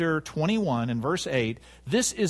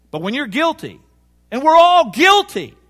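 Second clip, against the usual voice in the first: much louder, high-pitched cry from the pulpit; impassioned preaching; 10 semitones higher, 12 dB louder than usual. Real, not acted. Preacher telling the congregation of shared guilt, impassioned shout. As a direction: drive it home at full voice.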